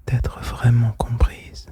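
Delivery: normal talking voice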